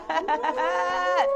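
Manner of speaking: high, laughing squeal of joy; excited squeal. Real, not acted. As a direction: shriek with delight at the reveal. Showing her weight sign.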